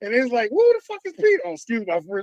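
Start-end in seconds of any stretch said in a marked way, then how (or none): 0.5-1.4 very high-pitched mock question; imitating baffled coworkers. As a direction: squeal the question as a bewildered coworker.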